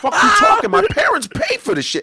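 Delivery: high, loud indignant shout; mock outraged yell. Comic firing back at a heckler, high mock outraged yell. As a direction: yell it back like you're offended.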